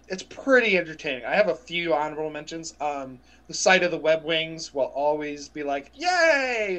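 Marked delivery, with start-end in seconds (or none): 5.9-6.8 high little cheer; delighted cheer. Retold or imitated. Co-host reenacting seeing the web wings, cheering. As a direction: cheer like a thrilled kid.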